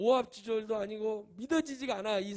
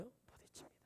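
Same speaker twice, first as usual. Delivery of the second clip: whisper; 27 dB quieter than usual. Acted. Preacher drops to a hush.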